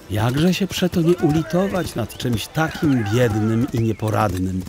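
ordinary delivery

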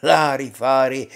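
loud, dismissive shout. A narrator's reading. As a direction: shout it down as nonsense. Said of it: "vzkřikl voják". (hlasitý výkřik vojáka)

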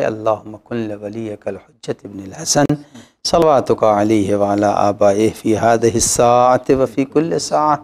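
low, even prayer chant fading away; recited prayer. Real, not acted. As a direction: intone the closing supplication devoutly. Closing Arabic dua recitation.

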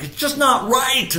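high, drawn-out wail of protest; acted tearful, angry pleading; upset pleading. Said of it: "as I'm crying, I'm pleading, I'm angry".

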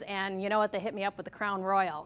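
usual speaking voice

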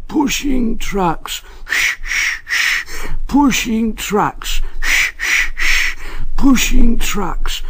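high, slow sing-song grumble of a sulky engine; grumbling engine mutter. A narrator's reading. Engine grumbling to himself as he pushes trucks, high and slow. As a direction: drone it out slowly like a fed-up engine. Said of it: "muttered to himself".

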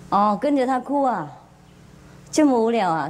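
usual speaking voice

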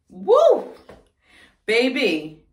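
high loud woo; excited whoop. Real, not acted. Exclaiming about week 25.

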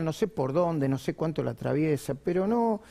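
normal talking voice

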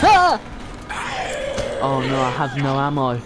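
startled yelp then loud panic; jump, then panic about the ammo; panicking gamer. Real, not acted.

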